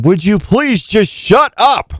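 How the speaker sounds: high, slow, put-on wife voice; mock whiny plea from the wife woken early; imitating his wife. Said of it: "Jenny's over there going".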